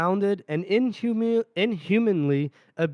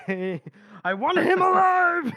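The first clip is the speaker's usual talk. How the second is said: furious shout; 12 semitones higher, much slower than usual. Acted. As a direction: bellow it, half laughing at the rage. Lawson's furious outburst.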